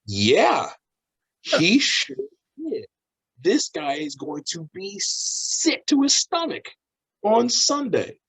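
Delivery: high-pitched, slow stunned voice; gobsmacked high drawl; dumbfounded. Acted. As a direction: draw it out high, as if jaw hanging open. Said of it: "with my mouth open like an idiot, just going".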